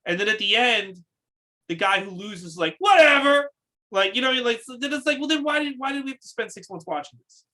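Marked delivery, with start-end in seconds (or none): 2.8-3.5 loud, high mock nonchalance; hammed-up careless loser; mock dismissive. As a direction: toss it out as an exaggerated, shouted who-cares. Mock shrug of the losing wrestler.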